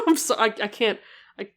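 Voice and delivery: cracking up mid-line; can't hold the laugh back. Real, not acted. Player breaking into laughter over the sprite's eyes. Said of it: "Try not to laugh".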